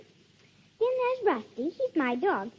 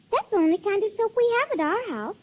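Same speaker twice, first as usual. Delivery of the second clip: growing louder and brighter toward the doll; a child bursting with pride, pitch climbing; excited child pitch; 4 semitones higher, 8 dB louder than usual. Acted. Child ad read, building excitement.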